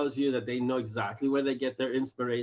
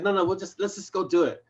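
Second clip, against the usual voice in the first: lightly acted brash impatience, higher pitch; Simon; 6 semitones higher than usual. Simon overruling cautious Monty, lightly brash. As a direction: brush off caution, a touch pushy. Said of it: "he's very brash".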